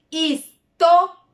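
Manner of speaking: histórico in separate syllables, punching TÓ; stress on antepenultimate syllable. Shown as a demonstration. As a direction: hit TÓ hard in histórico. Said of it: "en la antepenúltima sílaba tengo las palabras".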